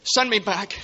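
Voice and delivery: high, loud cry of begging; frantic shout. Acted. George crying out to Clarence, frantic. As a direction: shout the plea to the sky.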